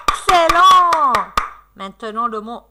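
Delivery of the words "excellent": loud, high, drawn-out cheer; enthusiastic praise. Real, not acted. Teacher praising the children's answer, enthusiastic. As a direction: praise them loudly, stretching it out.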